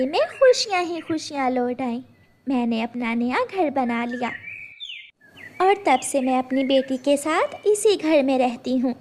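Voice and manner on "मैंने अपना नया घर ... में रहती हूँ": usual speaking voice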